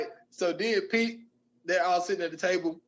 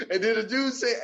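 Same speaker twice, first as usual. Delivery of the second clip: normal talking voice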